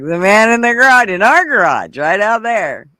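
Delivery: put-on a kid who won't be doubted; insistent child. Little boy telling his parents about the garage, a high insistent child voice.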